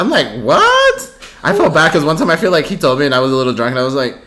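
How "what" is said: squeaky disbelieving what; shocked high what. Retold or imitated. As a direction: gasp it out in disbelief. Shocked reaction retold.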